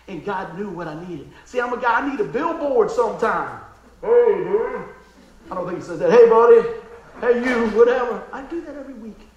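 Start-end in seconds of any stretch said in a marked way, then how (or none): 4.0-8.2 loud, playful hailing; mock call-out. Pastor joking how God gets his attention, loud playful call. Imitating God's billboard call.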